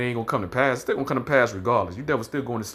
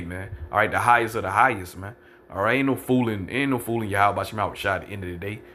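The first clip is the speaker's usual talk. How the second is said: ordinary talk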